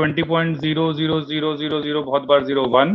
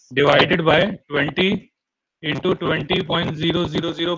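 slow dictation; much slower than usual. Shown as a demonstration. Dictating formula slowly to class.

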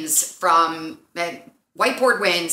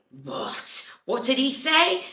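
put-on London boy; 6 semitones higher, slower than usual. London boy asking what was said about him, put-on questioning voice. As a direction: act out the London boy, second question pitched high. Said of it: "then London boy is like".

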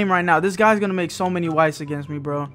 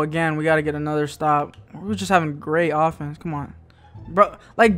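ordinary talk

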